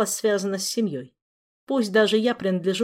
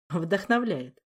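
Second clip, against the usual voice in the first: smirking; slower than usual. Acted. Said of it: "усмехнулся маэстро".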